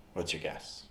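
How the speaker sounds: normal talking voice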